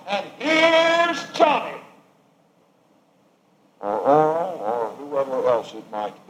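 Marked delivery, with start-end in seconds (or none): 0.1-1.8 high, slow drawn-out announcer call; Johnny Carson announcer. Retold or imitated. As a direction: sing it out like a talk-show host intro. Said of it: "the Johnny Carson show".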